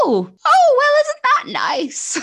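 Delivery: strained, shrill-free fake delight; high-pitched mum. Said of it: "her pitch was a lot higher".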